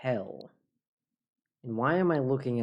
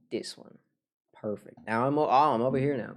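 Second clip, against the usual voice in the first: usual speaking voice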